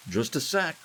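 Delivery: high, loud cheery call; cheerily called out. A narrator's reading. Lou calling through the bathroom door to family, cheerily. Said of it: "Lou cheerily called out".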